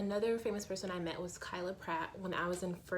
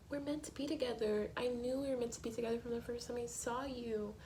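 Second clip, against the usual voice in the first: higher, soft earnest plea; pleading boyfriend; 6 semitones higher, 4 dB quieter than usual. Retold or imitated. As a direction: beg her to believe it. Jess pleading at Rory's dorm.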